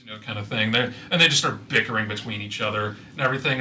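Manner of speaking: ordinary talk, nothing marked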